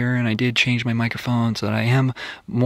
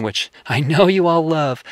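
ordinary delivery

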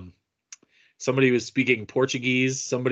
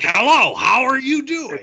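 much higher, louder mock German growl; harsh German; 8 dB louder than usual. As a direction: bark it out like a guttural German. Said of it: "But the Germanic languages are like".

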